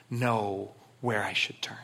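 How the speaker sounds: slow hushed whisper. Acted. David asking God for direction, slow whisper. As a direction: breathe the prayer slowly.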